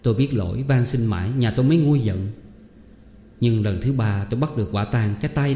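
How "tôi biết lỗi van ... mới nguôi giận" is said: usual speaking voice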